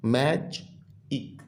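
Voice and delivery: "मैजिक" very slowly, in two softly separated syllables. Shown as a demonstration. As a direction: split "मैजिक" in two with a clear gap between. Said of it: "ये बच्चों टू word syllable है". ("मैजिक" को दो हिस्सों में तोड़कर, धीमी आवाज़ में)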